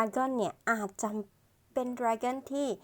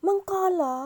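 high, louder, acted startled question; wife's yelped question; 10 semitones higher, 5 dB louder than usual. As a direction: yelp it like the startled wife. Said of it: "คนที่เป็นภรรยาก็พูดขึ้น".

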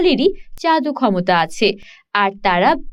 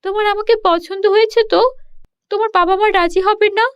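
higher and quicker, eager questions; eager mermaid; 8 semitones higher than usual. Acted. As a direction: rush to ask if he likes her. (উঁচু গলায় আগ্রহী জলপরি)

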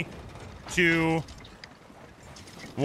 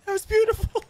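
high-pitched gushing with a laugh; gush, much higher than usual; delighted praise; 14 semitones higher than usual. Real, not acted. Praising crewmate's threat to the sloop, delighted.

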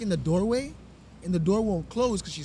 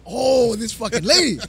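louder, slow exasperated grumble; fed-up outburst; 4 semitones higher, 10 dB louder, slower than usual. Retold or imitated. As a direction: let it out through exhaustion with her. Narrator fed up with lady.

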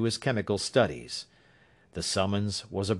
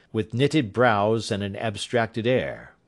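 usual speaking voice

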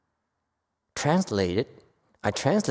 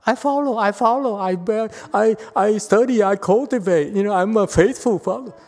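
higher, louder sing-song of a proud follower; mocking quote; 8 semitones higher, 5 dB louder than usual. Teacher mocks followers who only talk, higher and louder. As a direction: parody the devoted follower. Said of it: "And talk, talk".

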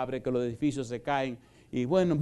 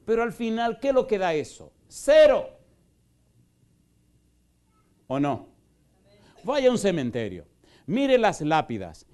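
fiery pulpit preaching, voice raised and pushing; full force preaching; 5 dB louder than usual. Acted. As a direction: thunder it at the congregation like a revival preacher.

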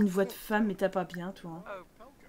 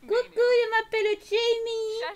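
high, slow, cutesy little creature voice; squeaky cartoon pet voice; 11 semitones higher, 4 dB louder, slower than usual. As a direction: play the kitten greeting sweetly. Voicing the cat Sim.

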